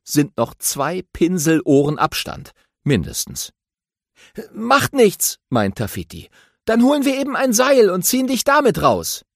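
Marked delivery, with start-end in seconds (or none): 4.5-9.3 higher, eager little hero voice; friendly young aardvark, voiced higher; Tafiti voice. A narrator's reading. Tafiti politely asks the grumpy badger for help, eager and high.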